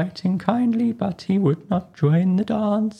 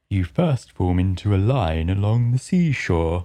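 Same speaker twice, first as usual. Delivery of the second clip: low, gruff creature voice; a rumbling Griffin; 11 semitones lower than usual. Acted. Griffin starts explaining the dance, low and gruff.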